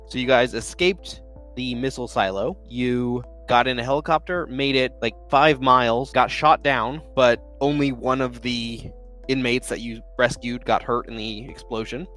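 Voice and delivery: normal talking voice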